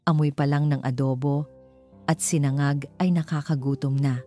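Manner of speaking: ordinary delivery, nothing marked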